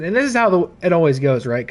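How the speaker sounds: ordinary delivery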